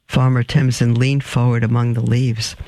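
ordinary delivery

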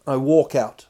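ordinary delivery

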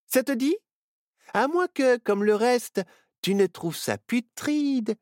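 put-on high, playful teasing girl voice. Myrtille teasing bored Barnabé, playful high voice. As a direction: coax him out of his sulk. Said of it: "le titilla-t-elle".

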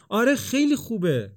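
high, loud cheering praise; enthusiastic. A narrator's reading. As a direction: cheer him on with real fire. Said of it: "با شور و حرارت".

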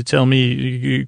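usual speaking voice

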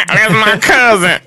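high, slow, laughing repeat; weird voice again. Retold or imitated. Storyteller imitating the man repeating the answer, high and slow with laughter. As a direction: stretch it out high through a laugh. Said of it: "he would say it just like that".

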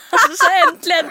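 high laughing squeal of joy. Real, not acted. Friend recalling her first kiss, squealing with laughter.

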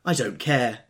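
Daisy crying out she doesn't care; cried out. A narrator's reading. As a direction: toss it out defiantly. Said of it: "cried Daisy".

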